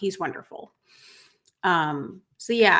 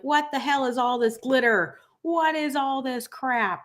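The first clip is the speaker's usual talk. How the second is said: annoyed complainer; 6 semitones higher than usual. Retold or imitated. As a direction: play the grumpy one griping about glitter. Annoyed people complain about glitter.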